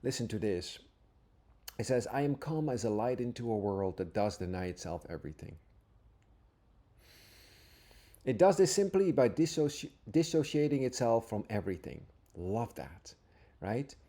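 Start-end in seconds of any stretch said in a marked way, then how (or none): 2.1-12.1 scripture reading. Acted. Teacher reading Jesus' words to listeners, earnest and reverent. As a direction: read each line as if it is holy.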